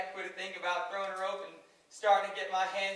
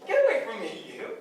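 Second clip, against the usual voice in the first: loud, high, mock-gruff brush-off; flustered; 4 semitones higher, 7 dB louder than usual. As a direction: push her away, half joking. Curly flustered, pushing her off.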